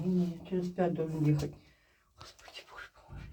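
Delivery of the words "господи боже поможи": unvoiced, very faint plea to God; real whisper, barely audible; whispered prayer. Real, not acted. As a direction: whisper a little prayer to yourself. Prays quietly while stuck.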